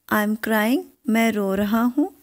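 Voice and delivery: ordinary talk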